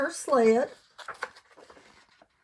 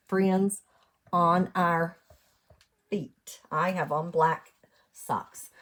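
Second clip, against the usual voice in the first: ordinary delivery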